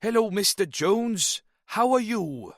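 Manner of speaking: put-on Welsh lilt, pitched high; sing-song Welsh greeting accent; fake Welsh accent. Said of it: "adopted a fake Welsh accent".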